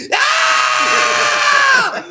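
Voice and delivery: high, loud startled shriek; mom's shriek. Retold or imitated. Mom discovering the mess, shrieking in alarm. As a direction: shriek like a shocked mother.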